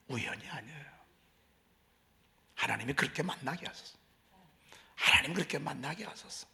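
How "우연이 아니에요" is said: 우연이 아니에요 whispered softly as a statement; whispered answer. Shown as a demonstration. Pastor answering his own question, in a whisper. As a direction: drop to a hush and answer your own question.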